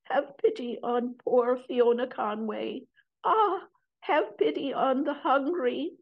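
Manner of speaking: high, pleading whispery cry. Acted. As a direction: beg weakly for pity. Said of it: "she said in a whispery voice".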